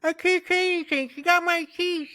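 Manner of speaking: very high, loud, fast squeak; squeaky mock voice. Mocking the missing friend in a squeaky high voice. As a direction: squeal it like a cartoon victim. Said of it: "mocking the missing friend".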